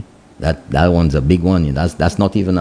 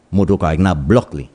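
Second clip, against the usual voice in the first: usual speaking voice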